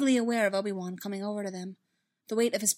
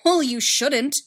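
loud, higher-pitched snap; bitter, forceful retort; snapping; 6 semitones higher, 11 dB louder, slower than usual. A narrator's reading. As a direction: snap back at a loved one. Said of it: "Anakin snapped".

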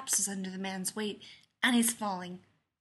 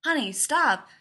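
loud, raised-pitch parental shout; alarmed call; 5 semitones higher, 7 dB louder than usual. A narrator's reading. Leonard calling after Joe as she runs off, alarmed shout. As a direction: call urgently after a runaway child. Father calls after running child.

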